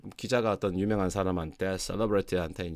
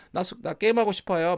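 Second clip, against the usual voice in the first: put-on higher, pleading kid voice, slightly louder; lightly voiced son; 10 semitones higher, 5 dB louder than usual. Son asking his parent to play games, pleading and higher.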